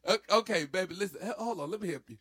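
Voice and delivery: high sassy put-down. Pretend. To an imagined person, a high sassy put-down. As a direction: cut them off with attitude.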